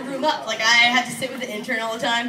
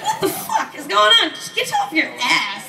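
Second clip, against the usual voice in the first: high-pitched comic outburst; hammed-up exasperated complaint, starting high; mock exasperation; 8 semitones higher than usual. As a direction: act fed up with lazy coworkers. Acted exasperation at messaging coworkers.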